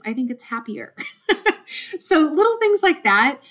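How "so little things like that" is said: high, loud, giggly words right after a laugh. Real, not acted. Host laughing at her own purple-pen habit, giggly and high. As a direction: laugh through it, amused at herself. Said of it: "I'm easily amused, evidently".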